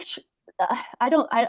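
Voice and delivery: ordinary talk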